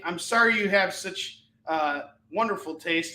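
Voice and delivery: ordinary delivery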